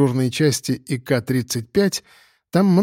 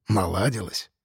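doubtful, quieter question; skeptical; 5 semitones lower, 6 dB quieter than usual. Acted. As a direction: question it with disbelief. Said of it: "Я скептически свёл брови". (с недоверием, скептически)

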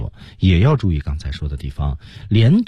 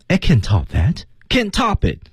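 "I can't top that" rising, "can't top it" high then falling; rise then fall; 10 semitones higher than usual. Shown as a demonstration. Coach shows students the intonation, "can't top it" high then falling. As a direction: lift the tune on "that", drop it on "it". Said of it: "它是有调的，先升后降".

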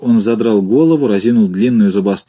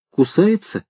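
wary; 6 semitones higher, slower than usual. A narrator's reading. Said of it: "спросил Фарфуркис опасливо".

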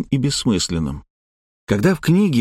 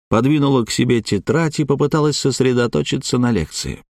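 ordinary delivery, nothing marked

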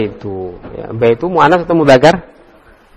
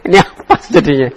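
laughing; faster than usual. Real, not acted. Teacher laughs at students' answer.

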